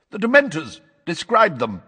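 high, loud, insistent; impatient command. Acted. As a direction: press the witness impatiently. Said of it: "said Madam Bones impatiently".

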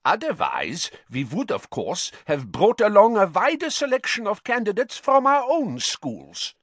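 complaint climbing very high; displeased foreign headmaster. Acted. Karkaroff objecting about his school's candidates, displeased. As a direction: build to a high-pitched grievance.

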